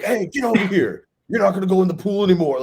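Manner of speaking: hammed-up parent flipping out, fast on the end; mock yell. Dad showing the yell he didn't use at his daughter, mock angry yell. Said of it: "I didn't go like".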